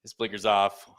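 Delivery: loud, high-pitched host announcement; show intro. Acted. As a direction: kick off the show at full projection. Opens the show.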